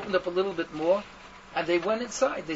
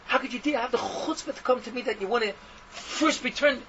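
raised, higher-pitched voice roaring in outrage; roaring rebuke; 6 semitones higher, 5 dB louder than usual. Retold or imitated. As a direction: thunder at him like an indignant rebbe. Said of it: "with his roaring voice".

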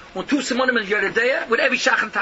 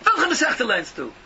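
higher, louder mock rebuke; a rabbi's indignant retold yell; 7 semitones higher, 4 dB louder than usual. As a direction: bark it like a rabbi scolding. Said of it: "But did he yell at me".

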